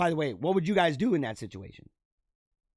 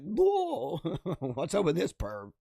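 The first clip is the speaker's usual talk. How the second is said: mock shock; 2 semitones higher than usual. Coworkers on the Zoom call, mock shocked and laughing. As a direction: gasp like a coworker catching it on camera. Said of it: "everybody's like".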